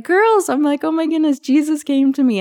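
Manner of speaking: high, loud, breathless-with-news quote; excited retelling. Retold or imitated. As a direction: imitate bursting out with news to the girls. Breaking news to her friends.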